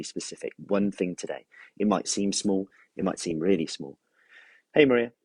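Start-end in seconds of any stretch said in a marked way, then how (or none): 4.7-5.1 cheerful greeting. Real, not acted. Greeting live viewer joining.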